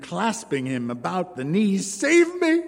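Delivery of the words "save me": high, loud cry of terror; panicked scream. A narrator's reading. Wife hears the dwarf coming, panicked high cry. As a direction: shriek it as the danger arrives. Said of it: "Jean-Marie cried".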